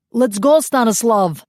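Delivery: loud high yell to a comrade; urgent yell to get away. A narrator's reading. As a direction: shout it across the clearing. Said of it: "the captured malcontent yelled".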